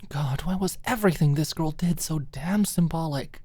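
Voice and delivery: ordinary delivery, nothing marked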